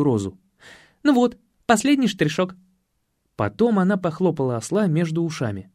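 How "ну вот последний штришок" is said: high, satisfied woman's voice; довольная Татьяна. Acted. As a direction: add the finishing touch in a high, pleased tone. Татьяна довольна своей работой. (довольно, высоким голоском)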